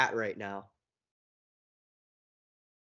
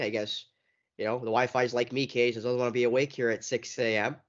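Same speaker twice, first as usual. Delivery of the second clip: ordinary delivery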